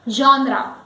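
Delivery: GENRE as ZHON-ra, falling; correct pronunciation. Shown as a demonstration. Teacher repeating the word, correct pronunciation. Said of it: "the word is genre".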